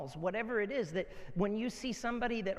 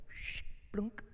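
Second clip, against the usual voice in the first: breathy whispered splash noise; imitated splash; 3 semitones higher, 4 dB quieter than usual. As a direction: mimic a starfish plopping into water. Kid tossing starfish into water.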